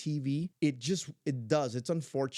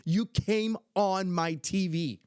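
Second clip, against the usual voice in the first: higher, slow, thrilled exclamation; imitating excited granddaughter; 6 semitones higher, 4 dB louder, slower than usual. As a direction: stretch every word in excited disbelief. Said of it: "She was so excited".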